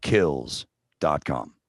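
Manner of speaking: "kills dot com" said slowly, each word spaced out; slow and deliberate. Shown as a demonstration. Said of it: "no apostrophe".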